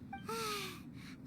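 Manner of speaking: a soft, weary sigh; tired sigh. Real, not acted. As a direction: let the breath out, worn out. Weary after puzzling research.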